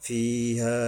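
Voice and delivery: ফিহা on a level note with long ee; one-alif elongation. Shown as a demonstration. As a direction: stretch the first vowel of ফিহা. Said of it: "ইয়ার উপরে এক আলিফ". (ফিহা এক আলিফ টেনে)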